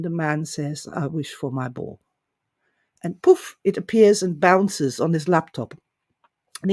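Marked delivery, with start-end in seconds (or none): 0.9-2.0 small, defeated, much softer plea; desperate. Retold or imitated. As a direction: give in, quiet and deflated. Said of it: "So in desperation".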